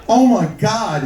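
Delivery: high, loud acted horror; hammed-up appalled outburst; shocked dad. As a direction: exclaim in mock shock.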